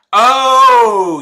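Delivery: shrieked high and loud. Acted.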